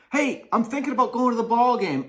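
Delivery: high, loud, fast eager pitch; excited invite. Pretend. Friend eagerly pitching a ball game to cosine, high and loud. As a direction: rush the idea out with glee.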